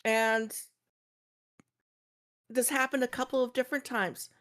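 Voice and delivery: normal talking voice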